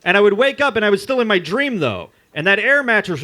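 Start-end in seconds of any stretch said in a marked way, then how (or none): none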